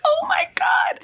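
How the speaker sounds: very high, whispery squeal; thrilled disbelief. Retold or imitated. Googling the yearly pay, thrilled disbelief. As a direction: squeak it out high, as if hushing your excitement.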